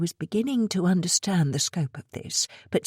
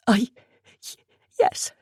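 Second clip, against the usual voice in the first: near tears; 7 semitones higher than usual. Acted. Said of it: "Abby was almost weeping".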